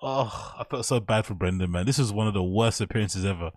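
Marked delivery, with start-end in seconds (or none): none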